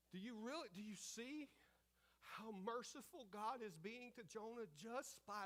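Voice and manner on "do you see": ordinary delivery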